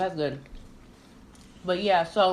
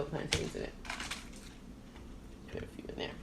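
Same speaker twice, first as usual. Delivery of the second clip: breathy whispered aside; whispering; 5 semitones lower, 16 dB quieter, faster than usual. Real, not acted. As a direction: whisper it softly, off-mic. Hushed aside while serving food.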